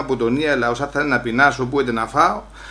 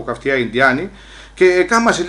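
ordinary delivery

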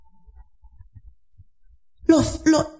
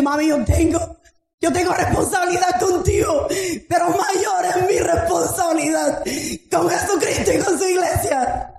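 strained, fervent plea retold to her mother; raised, urgent voice, insisting through the hurt; impassioned preaching; 4 semitones higher than usual. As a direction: plead with preacher's fervour.

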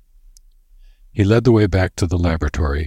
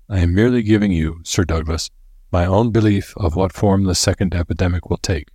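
ordinary delivery

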